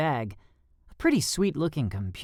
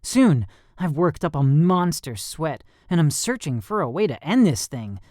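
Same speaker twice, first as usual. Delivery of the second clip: usual speaking voice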